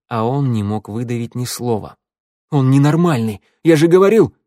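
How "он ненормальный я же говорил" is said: loud, higher heated accusation; flaring up. A narrator's reading. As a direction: burst out accusingly. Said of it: "вспыхнул Раду". (вспылив, громко)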